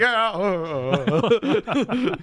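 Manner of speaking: high, drawn-out laughing "yeah"; hammed-up goofy laughing reaction; Robin Williams. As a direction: let it dissolve into mock laughter. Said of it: "That's how Williams does it".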